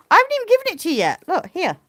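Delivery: high, fast, excited to the sheep; excited pet talk. Real, not acted. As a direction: rush it out playfully. Teasing the sheep.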